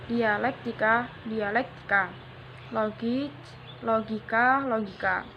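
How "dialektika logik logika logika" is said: normal talking voice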